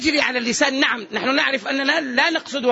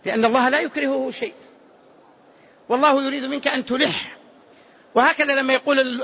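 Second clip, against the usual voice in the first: normal talking voice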